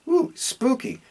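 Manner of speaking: high mock-scared ooh; mock spooky. Narrator joking about the gold doubloon ghost story, mock spooky. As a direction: tease the creepiness.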